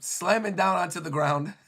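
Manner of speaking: ordinary talk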